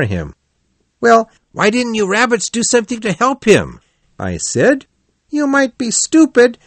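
high-pitched, animated storytelling; lively Otter character. Acted. Otter excitedly tells his search.

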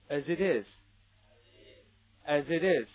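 "as it is" run together, each consonant joined to the following vowel; loud model of "as it is" as one linked unit; linked reading. Shown as a demonstration. Teacher showing two links for students to repeat, linked reading. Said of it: "又是两个连读".

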